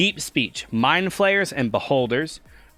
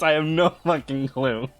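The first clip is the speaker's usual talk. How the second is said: ordinary delivery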